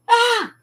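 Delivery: short, high, loud yelp; sharp re-enacted shriek; sudden mock scream. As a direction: imitate a prankster's sudden cry of pain. Janitor's sudden trick cry.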